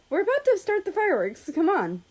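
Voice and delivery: bubbly, rushing to fetch them; excited girl voice. Acted. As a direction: Minnesota bouncing over to hurry them along, excited. Minnesota bounces over excitedly.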